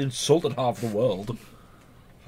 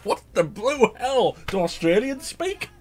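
mock outrage; 12 semitones higher, 4 dB louder, slower than usual. Mock outrage about languages.

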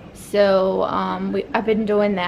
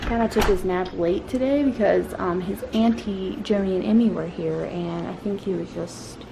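hushed talk; 7 dB quieter than usual. Real, not acted. Mom quietly updating viewers in the evening, hushed.

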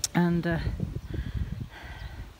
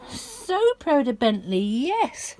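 a touch higher, slow cooing; lightly raised pet voice; 6 semitones higher, much slower than usual. Real, not acted. Praising the dog.

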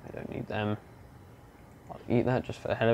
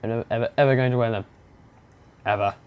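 fast, loud stress on each ever; emphatic refusal; 5 dB louder, much faster than usual. Real, not acted. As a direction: hammer every ever.

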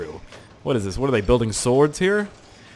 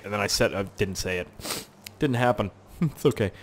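ordinary delivery

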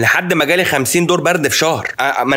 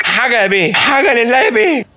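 begging beggar; 9 semitones higher than usual. Retold or imitated. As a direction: beg like a street beggar clinging to the car window. Beggar pleading at the window.

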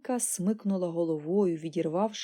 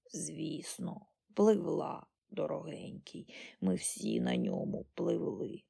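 put-on hushed, feeble old woman; frail old granny; 3 semitones lower, 5 dB quieter, slower than usual. A narrator's reading. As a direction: answer faintly, almost without voice. Ancient granny answers feebly.

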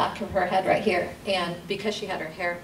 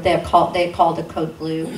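ordinary delivery

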